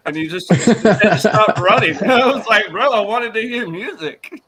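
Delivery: loud, high, laughing delivery; amused, voice climbing with laughter; laughing punchline. Real, not acted. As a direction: belt out the punchline through laughter. Laughing punchline of own story.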